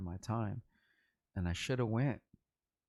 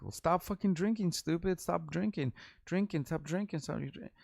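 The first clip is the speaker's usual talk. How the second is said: higher, nagging repeated orders; mad scolding; 7 semitones higher than usual. Retold or imitated. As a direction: imitate yourself snapping at a friend. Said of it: "literally got mad at him".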